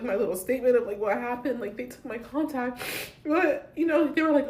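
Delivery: ordinary delivery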